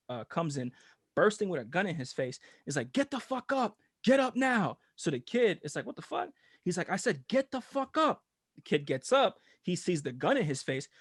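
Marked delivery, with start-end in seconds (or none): 2.9-4.8 high, urgent imitated police order; overzealous cop. Retelling a cop waking a kid, mock urgent command. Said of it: "this fucking overzealous cop". 5.8-6.3 high, soft, bewildered mimicry; startled kid. Retelling the sleeping kid's reply, hushed high startled voice. 6.9-8.2 high, slow, insistent mimicked order; overzealous cop. Retelling a cop repeating himself, high insistent command. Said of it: "this fucking overzealous cop".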